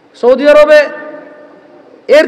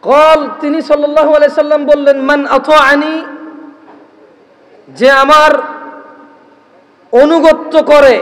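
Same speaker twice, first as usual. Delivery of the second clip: loud, measured sermon recitation with chanted Arabic; solemn preacher reciting scripture to the crowd; preaching a hadith; 3 dB louder, slower than usual. Acted. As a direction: intone the Arabic slowly, then preach the meaning.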